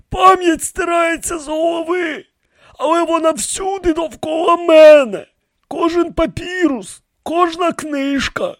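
very high character voice; high priest explaining himself. A narrator's reading. High priest defends himself to the pharaohs, pleading. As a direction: plead the excuse in a thin, high register.

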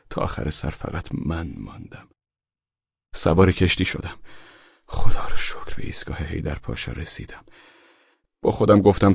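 usual speaking voice

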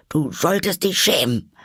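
high, loud stern rebuke; self-scolding. A narrator's reading. Alice scolding herself for crying, high and loud. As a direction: shame yourself sharply.

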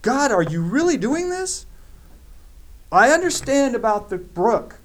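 high, loud, frantic questioning of God; a prophet at the end of his rope; panicked plea. Acted. Elijah panicking as he pleads with God, high and loud. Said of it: "You hear the panic in his voice".

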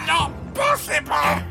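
high, drawn-out shriek of disbelief; terrified cry. Acted. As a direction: stretch the terror out slowly. Old man attacked by invisible thief.

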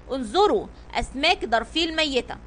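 ordinary delivery

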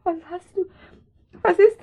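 high, loud frantic questions; rising panic. Acted. Mother panicking over her motionless daughter. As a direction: panic building as she sees the blood. Mother finds child hurt.

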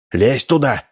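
ordinary delivery

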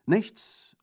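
high, loud one-word reply; emphatic refusal. A narrator's reading. Bandit declining Orso's offer, emphatic. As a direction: decline it ringingly.

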